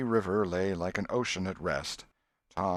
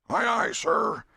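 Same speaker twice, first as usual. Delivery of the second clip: louder, higher sailor's answer; eager loud reply; 6 dB louder, slower than usual. A narrator's reading. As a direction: answer the captain smartly. Crew answers the captain.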